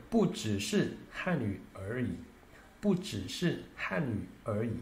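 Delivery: "不只是汉语而已" read slowly and carefully; slow Mandarin reading. Shown as a demonstration. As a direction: model the Mandarin sentence slowly. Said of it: "em chinês seria". (leitura lenta em mandarim)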